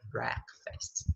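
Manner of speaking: Breakfast whispered, each sound clear; whispered model word. Shown as a demonstration. Teacher whispering Breakfast for the student to copy. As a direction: breathe out Breakfast softly. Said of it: "meio enrolado".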